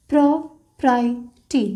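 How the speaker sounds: Property stretched very slowly while writing; drawn-out word. Shown as a demonstration. Teacher writing the stroke for students, very slow. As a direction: spell out property slowly, sound by sound. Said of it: "तो stroke create होगा".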